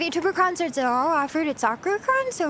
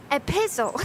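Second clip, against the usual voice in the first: epistle with PISS punched: e-PISS-ul; stress on second syllable. Shown as a demonstration. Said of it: "the stress is on".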